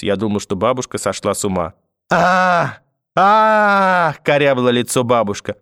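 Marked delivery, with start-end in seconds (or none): 2.1-4.1 high, drawn-out wailing cries; two loud wails, the second longer; screaming. A narrator's reading. Grandmother clutching her face, screaming. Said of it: "продолжая кричать".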